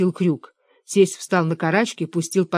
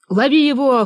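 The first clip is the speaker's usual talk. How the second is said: loud, high order; urgent hoarse cry; 7 semitones higher, 6 dB louder than usual. A narrator's reading. Father-in-law orders the catch under the shelves, urgent cry. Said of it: "хрипел тесть".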